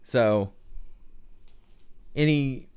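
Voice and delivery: ordinary talk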